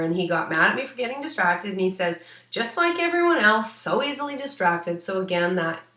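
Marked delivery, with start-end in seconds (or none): 2.5-5.0 high, lightly voiced scolding old man; a hint of a stern grandfather, higher; grumpy grandfather. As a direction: tut at her like a cranky grandpa. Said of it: "grandfather energy".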